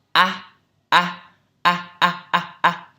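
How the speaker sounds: loud wide-open A drills; teacher drilling the A; exaggerated A. Shown as a demonstration. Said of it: "quá lên".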